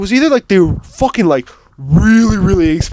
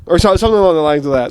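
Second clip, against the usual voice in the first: normal talking voice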